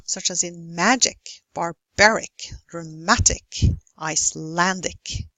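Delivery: MAgic, barBAric, draMAtic, IceLANdic; teacher's list, stressing MA, BA, MA, LAN; stress before -ic. Shown as a demonstration. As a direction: punch the syllable before "ic" in each word. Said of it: "you stress the syllable before ic".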